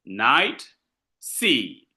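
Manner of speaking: "night" and "sea" each said alone, low and deliberate; stressed words alone. Shown as a demonstration. Teacher isolating the accented words for class, "night" and "sea" stressed. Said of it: "I insist on night".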